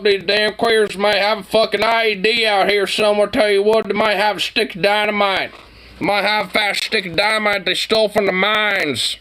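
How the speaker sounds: high, sneering hillbilly voice; mock paranoid hillbilly. Mocking the armed cowboy in a high yokel voice.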